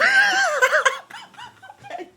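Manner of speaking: long, very high squeal of laughter. Real, not acted. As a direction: burst into a shrill, helpless cackle. Laughing at being asked to drive.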